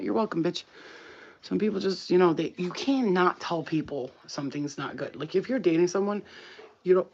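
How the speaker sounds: ordinary talk, nothing marked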